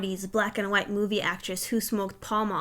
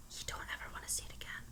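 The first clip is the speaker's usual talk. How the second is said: breathy ominous whisper; 19 dB quieter, faster than usual. Acted. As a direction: drop to a sudden whisper. Warning about going dark instantly.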